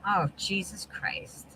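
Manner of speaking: breathy exasperated whisper under the breath; voiceless whispered oath; whispered curse. Real, not acted. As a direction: mutter it so no one hears. Exasperated aside while muted.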